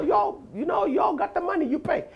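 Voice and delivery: ordinary talk, nothing marked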